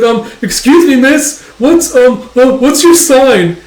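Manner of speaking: high, loud, stammering come-on; overexcited, tongue-tied suitor; flustered flirting. Acted. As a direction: fumble a pickup line nervously. Smitten man fumbles pickup line.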